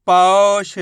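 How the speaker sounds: पौष as one firm, loud word; sounds joined. Shown as a demonstration. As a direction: blend पौ and ष without a pause. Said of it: "उच्चारण करके आपस में लाके".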